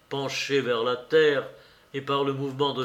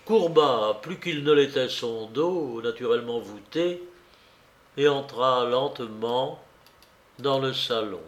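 ordinary delivery, nothing marked

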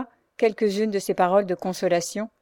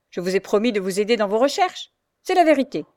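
sour; 2 semitones higher, 4 dB louder, faster than usual. Acted. The Frochard answers Louise with sour anger. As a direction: bite the words out bitterly. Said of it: "avec aigreur".

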